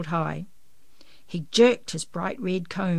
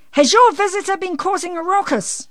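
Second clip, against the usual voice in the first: high, loud neighbourly shout; calling out; 13 semitones higher, 10 dB louder than usual. A narrator's reading. Missus Frogmore from her window to Janey, calling out. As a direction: call it across from a window, pitched right up. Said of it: "she called out".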